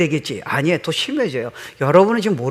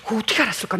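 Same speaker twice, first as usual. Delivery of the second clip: normal talking voice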